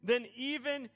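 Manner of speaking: loud, high, building sermon delivery; full-force preaching. Acted. Pastor preaching to the church, loud and rising. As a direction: preach it out over the music to the congregation.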